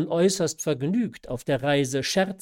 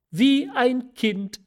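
high and slow, half laughing; mother's voice; 11 semitones higher, 7 dB louder, slower than usual. A narrator's reading. Mother tells her daughter about the weeping father, high and slow. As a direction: stretch it out high, amused and moved.

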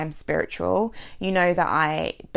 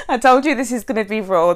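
laughing through tears of emotion, louder; 4 semitones higher, 7 dB louder, faster than usual. Real, not acted. To her listeners, a little overwhelmed, laughing as she speaks. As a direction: push the words out through a laugh.

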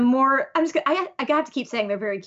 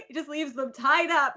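ordinary talk, nothing marked

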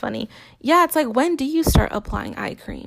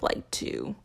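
whispered; 6 semitones lower, 12 dB quieter than usual. Real, not acted. Trimming number of kids.

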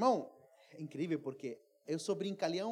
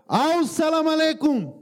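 loud, high, drawn-out salaam; hailing greeting; 6 semitones higher, 12 dB louder than usual. Retold or imitated. Hand raised to greet the bakery owner, loud calling greeting. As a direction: call it out across a room.

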